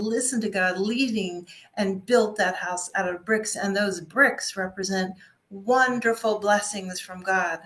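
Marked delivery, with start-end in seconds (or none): none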